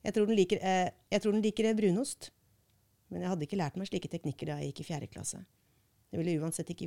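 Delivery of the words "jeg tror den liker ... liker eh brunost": a higher, louder line that stops at «eh», restarts «jeg tror den liker», and lands on «brunost»; restart with filler. Shown as a demonstration. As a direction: demonstrate a stuttering restart swapping in «brunost». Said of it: "slik at jeg sier".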